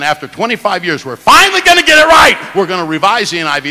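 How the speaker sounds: mocking gleeful crow, shouted high on finally right; gleeful mockery. Preacher mocking gleeful translators, shouting high. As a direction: ham up their glee, mocking it. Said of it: "they were so gleeful".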